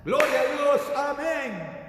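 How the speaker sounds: a hammed-up, loud churchy shout of praise; mock worshipper shout. Preacher mocking a churchgoer who praises loudly, hammed-up shout. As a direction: belt out praise like an eager churchgoer, tongue in cheek.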